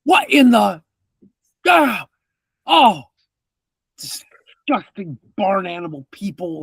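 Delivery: startled grumpy man finds the mess; disgusted NPC outburst. Acted. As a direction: spit out the insult at the barn animals. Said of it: "you hear this".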